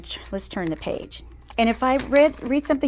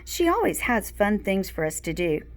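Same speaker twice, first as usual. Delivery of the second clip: ordinary talk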